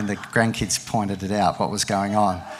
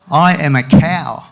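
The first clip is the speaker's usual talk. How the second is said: animated picture-book reading with raised, loud dialogue; story reading to a child; 6 dB louder than usual. Acted. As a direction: perform the animals' argument, drop for the tags. Reads picture book aloud.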